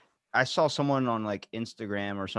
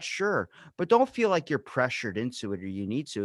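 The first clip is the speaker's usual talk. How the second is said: ordinary delivery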